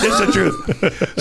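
laughing through the words. Real, not acted. Guest to host, vouching for his story, laughing. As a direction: insist on the truth while cracking up. Laughing while insisting it's true.

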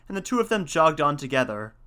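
ordinary delivery

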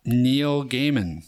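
drawn out, laughing; slow and laughing. Real, not acted. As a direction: stretch the name, cracking up. Joke guest, drawn out.

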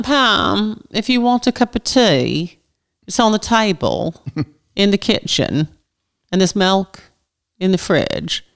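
put-on English accent with falling phrase ends; measured mock-English delivery with downward endings. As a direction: say it in English pieces, each dropping at the end. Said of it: "voices go down in the end".

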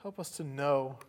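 soft, earnest prayer voice; closing prayer. Real, not acted. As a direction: pray quietly for the congregation.

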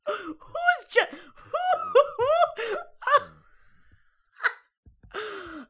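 can't get the word out for laughing. Real, not acted. Robocall mangles her name, laughing too hard to speak.

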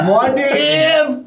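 high, drawn-out heroic wail; mock epic cry. Co-host interrupting the reading, mock epic cry. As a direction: cry the name out like a mock battle chant. Cries out the name over reading.

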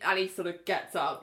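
ordinary talk, nothing marked

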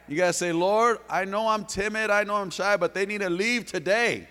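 loud, high, driving preacher's cry; full-force preaching. Acted. Pastor rousing the church to step out boldly, full-force preaching.

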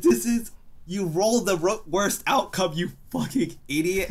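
high, fed-up scolding; exasperated rant. Retold or imitated. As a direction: berate him for blowing it. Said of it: "I was like".

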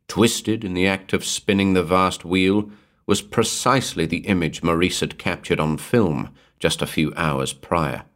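plain reading voice

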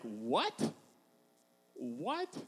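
soft, rising, incredulous questions; contemptuous disbelief. Acted. Goliath looking at his small challenger, incredulous contempt. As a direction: stretch each one up in mock disbelief. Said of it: "he's holding him in contempt".

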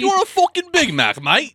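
hammed-up Australian accent, high and loud; rowdy cartoon Australian accent with a laugh in it; mock Australian accent. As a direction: yell like a crude Aussie cashier, in a high Australian accent. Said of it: "talking like that".

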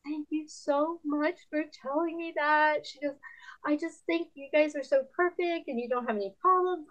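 high, softened imitation of a tearful woman; mildly choked-up gratitude, acted out; welled up in tears. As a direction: mimic someone touched and near tears. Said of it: "she just welled up in tears".

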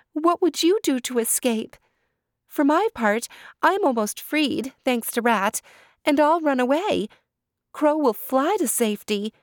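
put-on high, alarmed young deer; high anxious doe voice; Deer's voice. A narrator's reading. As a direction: cry out his name in worried surprise. Deer warns Turtle.

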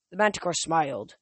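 ordinary delivery, nothing marked

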